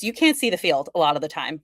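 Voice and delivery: ordinary talk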